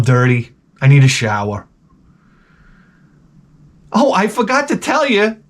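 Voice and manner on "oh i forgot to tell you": usual speaking voice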